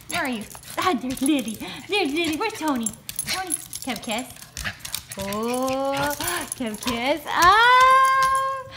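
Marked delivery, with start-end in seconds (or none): 3.8-7.2 lower, soft coaxing call. Real, not acted. Owner coaxing her dog for a kiss. 7.3-8.6 high loud delighted ah. Real, not acted. Owner delighted as her dog comes close.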